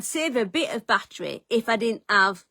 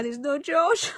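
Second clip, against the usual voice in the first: higher, breaking, near crying on "George"; near tears; 6 semitones higher, slower than usual. Real, not acted. Lost in the rainy forest, fearing she'll never find her car, voice breaking. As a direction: let the voice crack toward tears.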